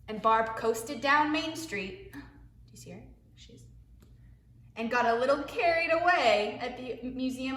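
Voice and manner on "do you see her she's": quiet. Real, not acted. Quiet aside to kids.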